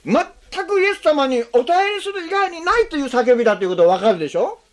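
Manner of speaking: ordinary talk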